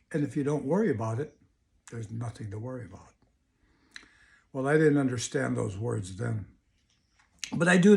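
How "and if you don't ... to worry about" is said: soft, quick, settling lower and quieter; imitated calm. Mother sits back and answers her angry child, very calmly. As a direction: reassure very calmly, voice dropping away softly. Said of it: "very calmly said".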